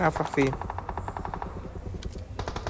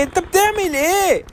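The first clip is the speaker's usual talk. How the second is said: "بتعمل" drawn out much higher and slower in disbelief; exasperated disbelief; 11 semitones higher, slower than usual. Real, not acted. Gamer asking a teammate in high, drawn-out disbelief. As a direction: stretch "انت بتعمل ايه" up high, can't believe it.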